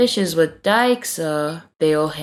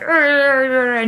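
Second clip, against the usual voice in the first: high, rolling arr noises; mock unhappy grumbling; 7 semitones higher, 3 dB louder than usual. Everyone at the picnic not happy, mock grumbling. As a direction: imitate a squabbling crowd without words. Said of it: "not happy".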